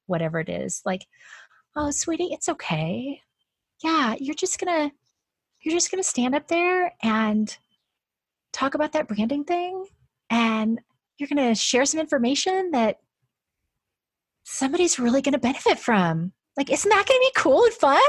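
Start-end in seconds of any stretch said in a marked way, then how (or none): 1.8-16.3 put-on talking to a child. Shown as a demonstration. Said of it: "how would you speak to a five-year-old". 16.6-18.0 much higher, louder and quicker, bright encouragement; cheerful encouragement to a child. Shown as a demonstration. Cheering a nervous child on, high, loud and quick. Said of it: "how would you speak to a five-year-old".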